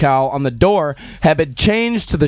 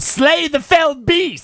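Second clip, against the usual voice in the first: mock battle cry; 10 semitones higher, slower than usual.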